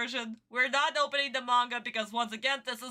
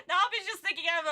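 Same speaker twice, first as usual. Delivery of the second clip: usual speaking voice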